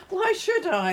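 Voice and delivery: high, scoffing challenge; indignant refusal. Acted. Fed-up relative refusing to come tomorrow, indignant. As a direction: throw it back, pitch leaping up.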